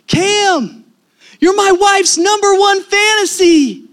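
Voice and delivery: high, loud, drawn-out yell; starstruck shout. Retold or imitated. Husband blurts at the player taking a selfie, starstruck shout. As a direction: holler it like an overexcited fan.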